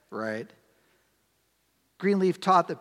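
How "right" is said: low, soft, deadpan confirmation; dry self-answer. Shown as a demonstration. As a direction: answer your own question quietly.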